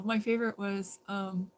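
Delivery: normal talking voice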